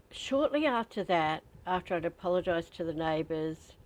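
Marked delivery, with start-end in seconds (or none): none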